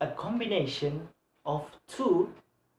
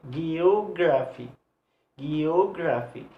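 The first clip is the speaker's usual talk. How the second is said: slow "Geography" with a long, stressed O. Shown as a demonstration. Teacher repeating the rule's example, drawn-out O in "Geography". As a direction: stretch the second syllable, ge-O-graphy. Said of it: "have a stress".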